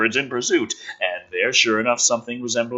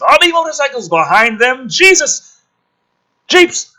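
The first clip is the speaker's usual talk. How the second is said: high, loud disbelieving exclaim; incredulous excitement; 13 semitones higher, 6 dB louder than usual. Real, not acted. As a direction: react as if the script got absurd. Reader reacts to over-the-top script.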